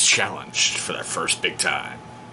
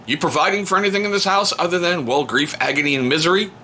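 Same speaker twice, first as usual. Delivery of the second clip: mock interrogation; 7 semitones higher than usual. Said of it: "I'm like".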